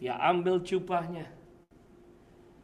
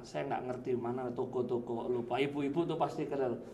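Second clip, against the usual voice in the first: ordinary talk, nothing marked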